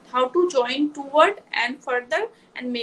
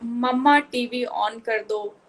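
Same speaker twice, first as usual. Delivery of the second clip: slow model sentence; much slower than usual. Shown as a demonstration. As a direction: spell it out slowly for a child. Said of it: "sentence की length बढ़ाओ".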